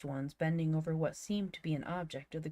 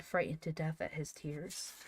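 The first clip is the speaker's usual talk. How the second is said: ordinary delivery, nothing marked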